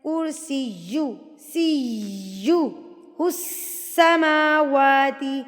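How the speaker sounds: slow سیہ with held "yy", long "ss" into السماواتی; pressed doubled consonants. Shown as a demonstration. Showing students tashdeed, doubled sounds pressed in کرسیہ السماواتی. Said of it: "زور دے کے پڑھنا ہے".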